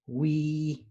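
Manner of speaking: repeated clearly for learners; Zapotec word again. Shown as a demonstration. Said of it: "one more time".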